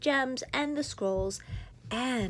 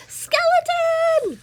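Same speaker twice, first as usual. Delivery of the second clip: long drawn-out high loud call; shout; 12 dB louder, much slower than usual. Acted. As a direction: Goblin calling out to his friend. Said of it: "shouted Goblin".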